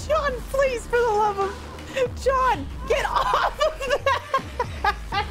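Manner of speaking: high, pleading through helpless laughter; laughing to tears. Real, not acted. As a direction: beg John while cracking up. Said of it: "I'm laughing that I'm starting to cry".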